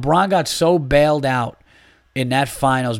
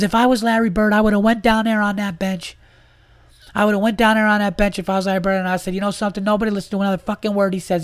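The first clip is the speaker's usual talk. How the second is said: imitating a ranting friend; 8 semitones higher than usual. Friend imagines being Larry Bird on the bench, high frantic rant. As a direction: do your buddy losing it over the coach, pitched way up. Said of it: "he talks really fast".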